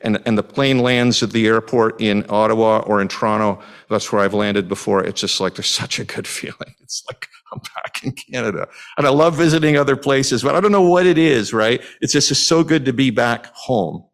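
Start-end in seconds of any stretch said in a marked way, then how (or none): 5.6-8.7 soft happy whisper. Real, not acted. Joy of landing back home.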